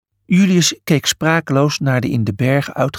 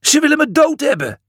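high, frightened wail; panicked; 12 semitones higher, faster than usual. A narrator's reading. Julius choking among the priests, panicked.

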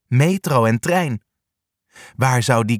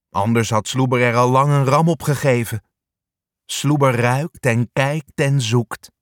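plain reading voice

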